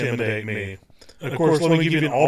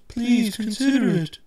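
higher, put-on pleading editor voice; mock pleading editor; 9 semitones higher than usual. Mimicking the editor urging him to resign, higher and pleading.

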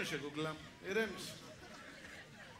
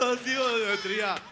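shouted count; 5 semitones higher, 8 dB louder than usual. Shown as a demonstration. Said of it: "Δυνατά να φωνάξεις".

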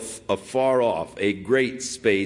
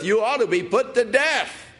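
yelling; 11 semitones higher than usual. Acted. David shouting his verdict at sleeping Abner. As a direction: hurl the sentence across the valley. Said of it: "He started yelling".

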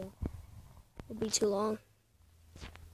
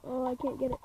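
ordinary delivery

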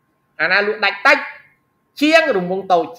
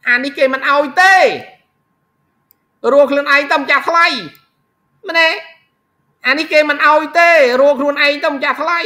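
loud, higher teacher's insistence; emphatic warning; 7 semitones higher, 5 dB louder than usual. Real, not acted. Teacher warns students about the exam, emphatic warning. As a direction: drive the point home in a raised voice.

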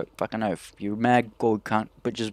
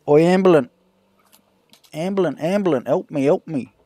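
higher, louder hammed-up yell; mock cry for help; 6 semitones higher, 7 dB louder than usual. Hailing the paramedic for a joke, hammed-up shout. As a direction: shout for the ambulance in fake panic. Calling out for the ambulance.

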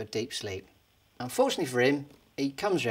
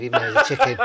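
breaking into laughter, rising high; laughing; 9 semitones higher, 8 dB louder, faster than usual. Real, not acted. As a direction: giggle through the punchline aside. Teller laughing at own joke.

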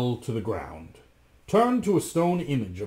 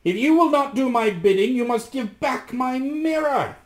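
high, loud magician's demand; commanding shout; 14 semitones higher, 7 dB louder than usual. A narrator's reading. Magician demands the mirror back, shouting high. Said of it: "shouted the magician".

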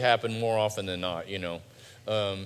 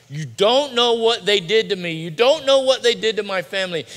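loud, higher-pitched protest; hurt protest; 8 semitones higher, 9 dB louder than usual. Acted. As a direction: protest forcefully, voice raised in hurt. Voicing a hurt congregant's protest.

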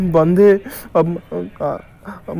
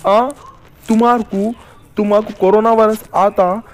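higher, louder macho boast; mock tough threat; 6 semitones higher, 3 dB louder than usual. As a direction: bluster like a hero protecting his girl. Boyfriend vows to break hands.

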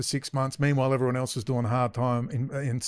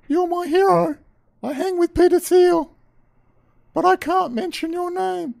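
much higher, louder sneering voice; mock fan voice; 7 dB louder than usual. Speaker parodies a gushing admirer in a high mocking voice.

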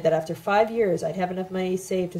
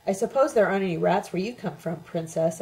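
ordinary delivery, nothing marked

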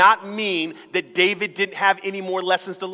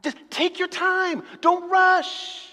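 calming high voice; 10 semitones higher, slower than usual. Acted. Calming reply in quarrel.

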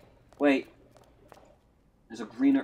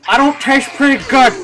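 loud joking shout; 10 semitones higher, 12 dB louder than usual. Acted. Shouted joke line.

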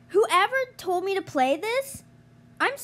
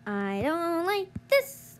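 usual speaking voice

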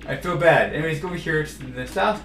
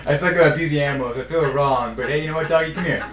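ordinary talk, nothing marked

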